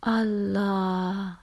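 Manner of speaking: lightly imitated Arabic-tinged accent, drawn out; mother's tilted accent. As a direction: announce it in mother's tilted accent, vowels held long. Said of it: "announce in her tilted accent".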